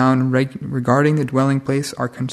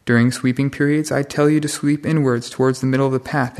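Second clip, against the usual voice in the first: ordinary talk, nothing marked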